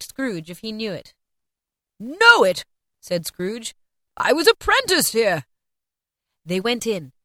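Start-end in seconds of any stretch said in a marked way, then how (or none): 2.0-2.6 high, loud, incredulous question; thrilled Scrooge, voice leaping up; excited exclamation. A narrator's reading. Scrooge recognises his old workplace. 4.2-5.4 high, loud, thrilled outburst; elated Scrooge, voice raised; excited recognition. A narrator's reading. Scrooge recognises his old workplace.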